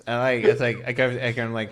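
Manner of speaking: ordinary delivery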